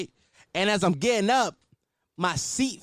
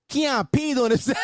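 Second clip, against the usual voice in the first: mild higher-pitched yell imitating little brother; mock yell; 5 semitones higher than usual. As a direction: call it out like a tattling kid brother. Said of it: "He just yelled that shit".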